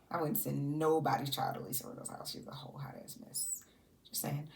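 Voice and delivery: shady whispered dig; whispered aside. Real, not acted. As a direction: whisper the shade conspiratorially. Gossip about Lisa Rinna.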